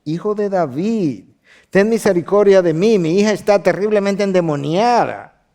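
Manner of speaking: rising to a loud, pleading cry; desperate cry. Retold or imitated. Mother begging Jesus to heal her daughter, loud plea. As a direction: cry out to him for mercy. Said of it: "comenzó a gritar".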